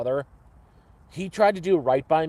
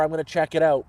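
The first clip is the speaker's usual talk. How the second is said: ordinary talk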